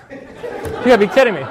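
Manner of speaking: laughing it out in disbelief; can't believe it, chuckling through the words; amused incredulous laugh. Retold or imitated. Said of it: "I literally started laughing".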